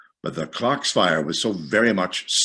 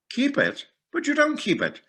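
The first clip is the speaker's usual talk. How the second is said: much higher, protesting nephew; nephew's voice. A narrator's reading. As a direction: push up high in disbelief.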